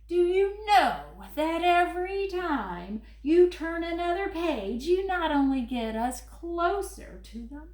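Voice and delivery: furry monster Grover, fretful and pleading. Acted. Grover reading his book to the kids, worried and pleading. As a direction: play lovable Grover begging the child not to turn pages.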